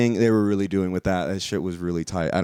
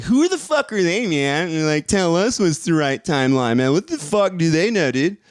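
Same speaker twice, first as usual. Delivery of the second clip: much higher, whiny defiant rant; angsty rant; 8 semitones higher, 4 dB louder than usual. Retold or imitated. Mocking the rulers of the timeline with an angsty teen whine. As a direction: play a sulky teen railing against authority. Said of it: "that angsty vibe of just like".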